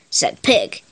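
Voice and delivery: usual speaking voice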